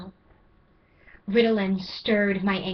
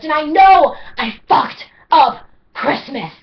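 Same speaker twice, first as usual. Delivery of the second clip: higher, louder, each fucked hit hard; anguished shouting; 4 semitones higher, 11 dB louder than usual. Acted. Poet confessing failures, anguished shout. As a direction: hurl each confession out in pain.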